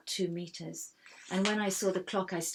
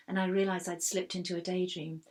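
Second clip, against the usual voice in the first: plain reading voice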